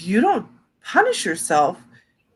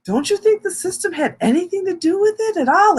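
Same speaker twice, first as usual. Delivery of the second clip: therapist voice; 10 semitones higher than usual. Retold or imitated. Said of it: "she's like".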